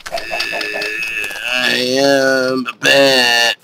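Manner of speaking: very slow, eerie creature voice; spooky bat voice. Pretend. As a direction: drag it out like a creepy bat. Announces himself as bat.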